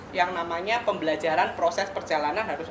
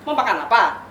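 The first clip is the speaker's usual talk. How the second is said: louder, sharp edge on "Mau makan apa?"; harsh tone; 3 semitones higher, 6 dB louder than usual. Shown as a demonstration. Pastor showing a harsh tone to the couple, sharp and loud. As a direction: snap the question like an irritated spouse. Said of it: "Sama, tapi nadanya berbeda".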